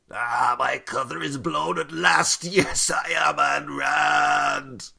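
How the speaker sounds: theatrical gloating reveal; hammy villain reveal. Pretend. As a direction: unmask yourself like a stage villain. Mock confession as Ayn Rand.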